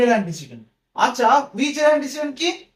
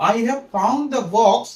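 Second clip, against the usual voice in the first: very slow, word by word, as if writing on the board; slow dictation; much slower than usual. Shown as a demonstration. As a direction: dictate it slowly for the class. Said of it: "second সেন্টেন্সটা লিখেছি". (বোর্ডে লেখার মতো খুব ধীরে বলা)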